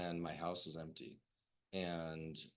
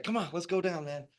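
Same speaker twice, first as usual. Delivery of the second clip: cheery invitation; 11 semitones higher, 9 dB louder than usual. Retold or imitated. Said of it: "He was just cheery as fuck".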